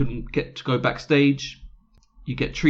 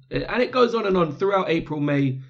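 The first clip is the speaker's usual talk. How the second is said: normal talking voice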